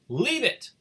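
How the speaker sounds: high, loud mock order; loud "Leave it! Leave it!"; barked command. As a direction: bark it like a strict owner. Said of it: "only a human could come up with order".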